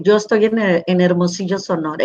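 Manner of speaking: ordinary delivery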